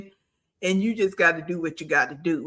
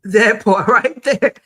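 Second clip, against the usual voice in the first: laughing, much louder; 7 dB louder than usual. Real, not acted. Laughing while stressing point.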